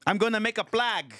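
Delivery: put-on high-pitched, indignant Filipino accent; livid Filipino tenant. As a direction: protest like a fed-up Filipino tenant. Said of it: "Filipino tenant that's fucking just livid".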